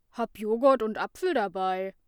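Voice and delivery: loud announcement at the same pitch; booming. A narrator's reading. Lasse announces his lunch to Ida, loud. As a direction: boom it across the room. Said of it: "tönte Lasse".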